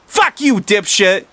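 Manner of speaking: high, loud insult; acted furious yell. A narrator's reading. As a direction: hurl it out as a foul-mouthed kid. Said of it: "yelled Potty Mouth Martha".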